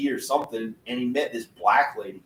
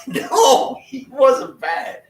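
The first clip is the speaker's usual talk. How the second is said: much higher, slow, drawn-out put-on voice; imitating cousin John Conway; 13 semitones higher, 9 dB louder, much slower than usual. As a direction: stretch it out high like the late cousin. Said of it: "He talked like this".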